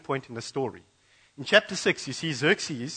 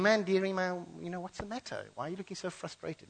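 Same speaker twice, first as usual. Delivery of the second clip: fussing wife; 5 semitones higher, 6 dB quieter than usual. Acted. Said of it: "his wife says to him".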